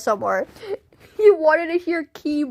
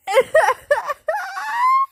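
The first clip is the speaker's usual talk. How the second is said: high shrieking laugh, near tears. Real, not acted. Streamer losing it over the chat, shrieking laugh. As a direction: let out a wild shriek of laughter. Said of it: "I'm crying".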